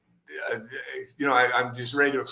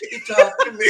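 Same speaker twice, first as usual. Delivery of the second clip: much higher, laughing it out; giddy laughing squeal; 7 dB louder than usual. Retold or imitated. Telling the editor about the idol's call, giddy laughing. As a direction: burst with delight through laughter. Calling editor after talking to idol.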